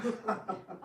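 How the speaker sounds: short sly laugh; mocking chuckle. Plotter teasing Balaram, short laugh. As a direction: chuckle at the rival.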